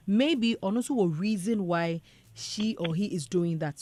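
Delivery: normal talking voice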